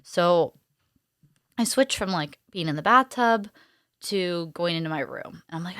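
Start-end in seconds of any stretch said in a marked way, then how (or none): none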